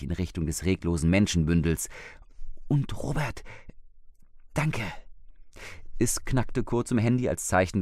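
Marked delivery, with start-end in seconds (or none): 2.7-4.8 higher, a hint of an anxious wife; lightly voiced frightened older woman. Wife reports the dead man to husband, lightly anxious. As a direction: let a shaken woman report it.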